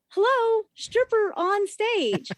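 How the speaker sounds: sarcastic. Retold or imitated. Mocking a distracted customer.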